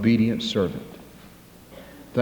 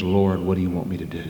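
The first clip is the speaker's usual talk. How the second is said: lower, softer, quick humble question; a touch of a humbled voice; submissive Saul; 5 semitones lower, 5 dB quieter, faster than usual. Acted. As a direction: ask it meekly. Saul asks the Lord.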